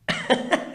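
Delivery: bursts into a short high laugh at his own line. Real, not acted. Speaker laughing at his own reply to the client. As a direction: laugh at the comeback.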